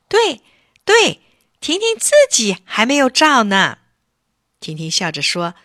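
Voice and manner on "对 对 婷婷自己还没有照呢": put-on much higher, louder chorus of kids; eager agreement. Children agree Tingting still needs a photo, excited. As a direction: chime in excitedly.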